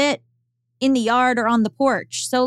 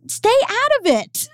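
mock snapping back; 13 semitones higher than usual. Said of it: "I'm like".

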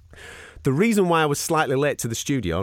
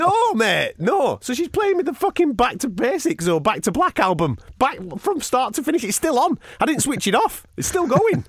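high-pitched comic rant; worked-up outrage, pitch climbing; exasperated; 12 semitones higher than usual. Real, not acted. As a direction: complain in mounting disbelief. Exasperated rant about music left playing.

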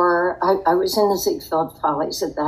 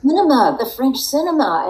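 excited; 8 semitones higher, 4 dB louder than usual. Real, not acted. Eagerly agrees with interviewer's suggestion.

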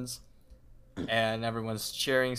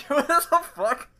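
much higher and louder, cracking up; laughing outburst; 8 dB louder than usual. Real, not acted. Friend cracking up at the crude joke, high and loud. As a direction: burst out laughing in disbelief.

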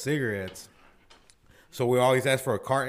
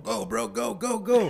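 urgent go go; 8 semitones higher, slower than usual. Retold or imitated. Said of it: "He's like".

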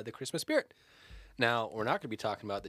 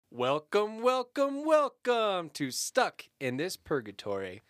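hammed-up host welcome, rising on the second; show intro; 6 semitones higher, 3 dB louder, slower than usual. Host opening the podcast, a hammed-up announcer welcome.

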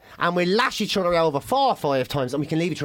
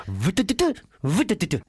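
stuttering buzz and tick; imitated friction noise. Comic imitating dry friction, juddering mouth noise. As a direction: sputter out a rhythmic juddering noise. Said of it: "And then it's just".

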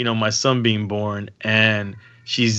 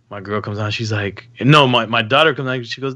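ordinary delivery

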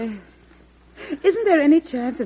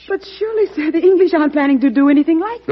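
sharply higher and louder, caught off guard; surprised protest; 6 semitones higher, 6 dB louder than usual. Acted. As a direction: protest in disbelief to the president. Secretary objects to the president.

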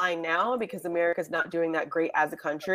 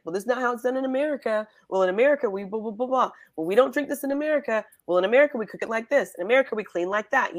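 fast, higher, smug comparison; mock pretentious American; 4 semitones higher, much faster than usual. Podcaster mocking a tourist who compares everything to America, smug. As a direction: rattle off America this, America that. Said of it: "You don't have to be like".

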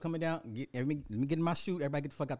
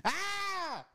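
high excited shriek. Real, not acted. Excited cry about Gundams.

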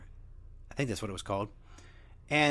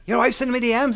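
higher, much louder yelled taunt; 10 semitones higher, 9 dB louder than usual. Retold or imitated. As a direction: scream the taunt. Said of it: "I get he was mad".

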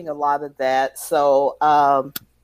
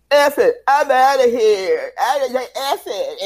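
Italian-sounding chef; 5 semitones higher, 4 dB louder than usual. Retold or imitated. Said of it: "Sounds very Italian still".

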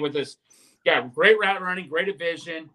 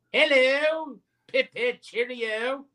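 a hint of a British accent, higher and put on; mock British accent; 6 semitones higher, much slower than usual. Asked for an accent on the show, a lightly voiced British toff. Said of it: "any accents you wanna break out".

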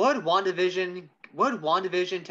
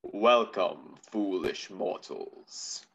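deep, slow ghost host voice; slow theatrical villain menace; 8 semitones lower, 5 dB quieter, much slower than usual. Acted. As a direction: greet them like a sinister ghost host. Haunted Mansion host greeting.

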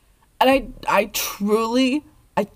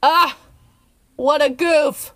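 exasperated; 7 semitones higher, 3 dB louder, slower than usual. Real, not acted. Mock scolding the characters.

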